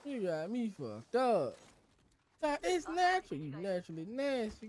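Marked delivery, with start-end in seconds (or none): 2.6-3.4 high, sarcastic "It's natural"; mocking quote. Streamer mocking the excuse, high sarcastic squeal. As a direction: jump up high to mock the excuse.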